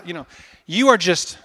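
ordinary talk

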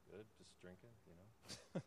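reply quietly, as if alone at the bar; a hushed, offhand retold answer; subdued retold reply. Downcast reply retold from lonely bar.

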